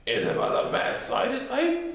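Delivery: high, breathy character voice; lightly whispered high character voice; eager character voice. Acted. Tigger-like character voice in story.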